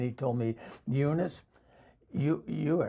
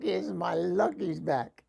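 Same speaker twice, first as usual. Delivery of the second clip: normal talking voice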